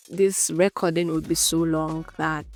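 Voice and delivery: ordinary delivery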